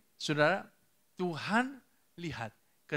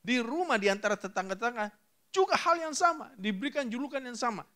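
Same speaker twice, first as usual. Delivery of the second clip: high, lifted preaching voice; animated pulpit cry; impassioned preaching; 9 semitones higher, 4 dB louder than usual. Real, not acted. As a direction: proclaim it fervently to the congregation. Preaches with raised voice.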